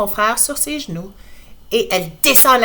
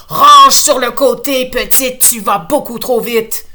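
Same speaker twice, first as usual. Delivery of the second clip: police order; 3 semitones higher, 9 dB louder than usual. Acted. Policeman orders Laurie over.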